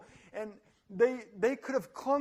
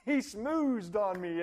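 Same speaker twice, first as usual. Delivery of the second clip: higher, louder whiny protest; Eve playing victim; 4 semitones higher, 6 dB louder than usual. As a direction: wail that it isn't her fault. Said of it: "And she goes".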